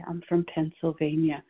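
ordinary talk, nothing marked

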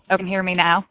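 ordinary talk, nothing marked

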